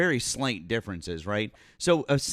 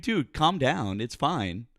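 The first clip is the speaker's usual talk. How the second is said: ordinary talk, nothing marked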